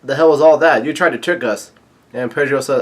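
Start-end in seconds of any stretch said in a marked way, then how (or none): 0.0-1.7 louder, higher-pitched accusation; indignant mock yell. Luffy confronting Pudding over the trick, indignant mock yell. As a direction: demand an answer, punching each question. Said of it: "yells out".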